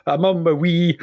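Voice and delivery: imitated strong Scottish accent. Host mimics the strongly Scottish song, Scottish accent. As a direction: put on the singers' Scottish accent. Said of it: "it's so Scottish".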